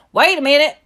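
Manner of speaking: high, loud urgent call; hollering. A narrator's reading. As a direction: holler to stop them in a Southern accent. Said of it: "I hollered".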